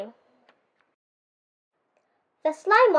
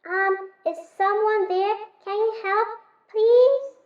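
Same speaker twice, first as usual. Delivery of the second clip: higher, small timid voice, rising on please; a tiny voice calling from inside; faint muffled plea; 5 semitones higher than usual. A narrator's reading. Pippi calls from inside the chest, small and pleading.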